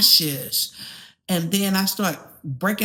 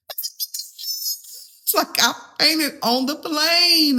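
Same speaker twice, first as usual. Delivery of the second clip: slow, higher, drawn out; incredulous; 10 semitones higher, much slower than usual. Real, not acted. Recalling fainting mid-flight, slow disbelief. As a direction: stretch it in disbelief.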